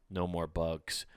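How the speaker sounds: low and soft, understated; quiet savoring. Real, not acted. Comedian relishing frost in the morning, quietly understated. Savoring fall before a mock rally.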